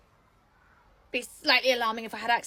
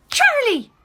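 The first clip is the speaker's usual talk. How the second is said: loud, high call of a name; shocked loud call; sharp call; 12 semitones higher, 13 dB louder than usual. Acted. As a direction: snap her name out to get her attention. Calling out to a colleague.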